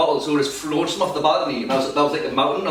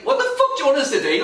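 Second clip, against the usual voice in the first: pitched-up retold exasperated shout; exasperated yell; 12 semitones higher than usual. Arguing with the old driver behind, fed-up retold shout.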